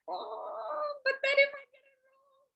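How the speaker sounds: mock her own nervous self, sing-song; playful imitation of a worried mind; anxious inner voice. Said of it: "But then there's this fear".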